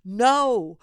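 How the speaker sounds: a loud, firm refusal; addressing representatives, a loud emphatic no. Pretend. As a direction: plant your feet and say no to them. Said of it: "Tell your representatives".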